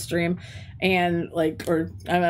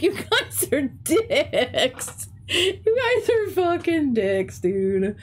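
slow, stretched, laughing mock insult; laughing drawn-out insult; 6 semitones higher, much slower than usual. Teacher slowly, laughingly calls her students dicks. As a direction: drag out each word through a laugh.

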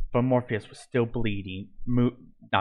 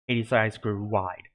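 ordinary delivery, nothing marked

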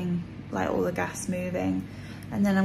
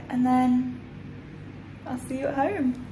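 ordinary delivery

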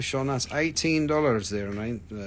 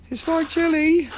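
jelly squeaked up high and a bit louder; squealed jelly; 6 dB louder than usual. Shown as a demonstration. Repeating the pendant description to the chat, squealed up high. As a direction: squeal it like a delighted cartoon voice.

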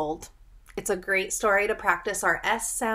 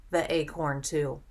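ordinary delivery

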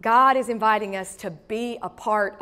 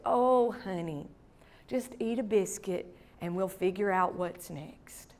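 tender comfort; 3 semitones lower, 8 dB quieter than usual. Retold or imitated. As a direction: gently reassure a heartbroken granddaughter. Grandma comforting heartbroken granddaughter.

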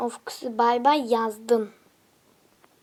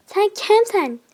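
higher, louder indignant challenge; 8 semitones higher, 8 dB louder, slower than usual. Real, not acted. To an opponent in the game, loud indignant challenge. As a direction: demand who they are.

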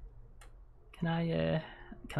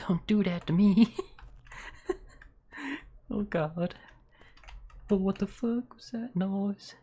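usual speaking voice

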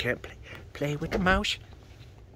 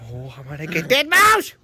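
excited yell; 6 semitones higher, 5 dB louder than usual. Real, not acted. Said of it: "I'm screaming".

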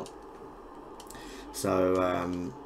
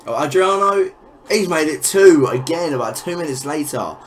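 loud and higher, reacting as the goal goes in; loud dismayed outburst; 6 semitones higher, 8 dB louder than usual. Real, not acted. Gamer yelling as his rival scores again, loud dismayed outburst. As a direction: shout it out in shock.

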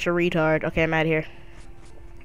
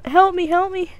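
mock cry for help; 10 semitones higher, 3 dB louder than usual. Professor Birch calling for help.

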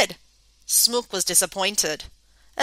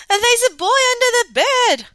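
high frightened cry; terrified shout; 13 semitones higher, 8 dB louder than usual. Acted. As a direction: yell it out in panic. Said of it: "screamed Smook".

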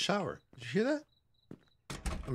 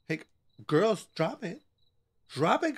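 ordinary talk, nothing marked

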